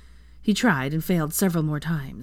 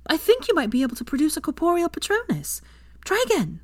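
higher eager urging; 11 semitones higher than usual. A narrator's reading. Hermione urging Draco to try again, eager. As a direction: urge him to try again. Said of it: "she urged".

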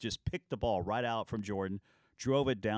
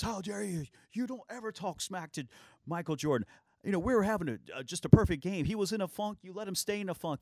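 much higher, indignant scolding voice; angry teammate; 9 semitones higher than usual. Retold or imitated. Teammate to the trash-talker in the locker room, high outraged scolding. Byron Scott chewing out teammate.

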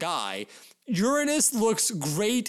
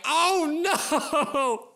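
mock sob; 6 semitones higher, much slower than usual. Real, not acted. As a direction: wail it, overwhelmed by cuteness. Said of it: "Now I'm actually crying".

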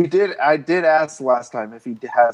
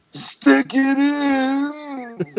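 very high, extremely drawn-out; hammed-up horror double, stretching every word; tethered porno voice; 12 semitones higher, much slower than usual. Acting out an Us porno, hammed-up tethered voice.

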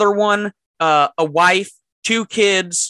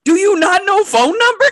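laughing disbelief; 5 dB louder than usual. Real, not acted. Realizing the misunderstanding, laughing.

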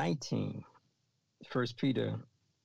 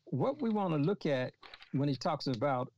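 normal talking voice

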